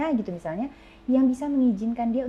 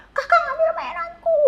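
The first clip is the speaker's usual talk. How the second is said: put-on high, whiny little kid; high childish complaint; small child voice. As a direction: squeak it like a five-year-old tattling. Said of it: "Dia bilang gitu kan".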